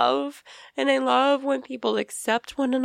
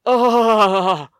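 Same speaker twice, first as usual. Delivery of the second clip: imitating a gasp; 6 dB louder than usual. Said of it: "she literally went".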